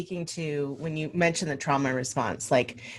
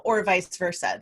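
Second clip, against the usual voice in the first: ordinary delivery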